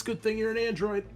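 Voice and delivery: ordinary delivery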